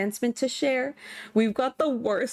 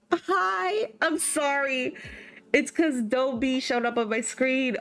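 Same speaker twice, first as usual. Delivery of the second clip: high-pitched, delighted squeal; gushing excitement; 8 semitones higher, slower than usual. Real, not acted. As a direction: gush in a high voice at a thrilling find. Greeting the character just found.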